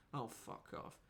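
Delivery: whispered, fed-up oh, fuck off; muttered disgust. Real, not acted. Reacting to a reader's letter, whispered disgust. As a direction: breathe it out under your breath in disgust.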